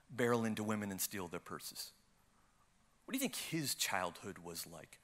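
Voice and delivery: low, soft, measured inner voice; voice of God. Retold or imitated. Spirit of God questioning an angry man, low soft voice. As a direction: ask gently and quietly, with long pauses. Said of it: "the Spirit of God begins to speak to me".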